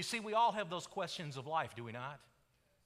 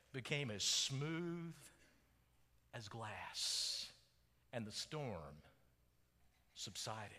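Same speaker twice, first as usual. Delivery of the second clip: hushed preaching; 8 semitones lower, 7 dB quieter than usual. Acted. Preacher telling how the storm subsided into peace, hushed. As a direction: let it sink gently into stillness.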